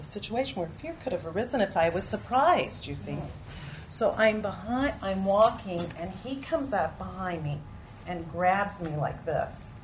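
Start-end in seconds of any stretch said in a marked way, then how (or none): none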